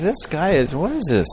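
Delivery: lightly acted incredulous complaint; mild exasperation. Krishna reacting to Brahma's theft, mildly exasperated.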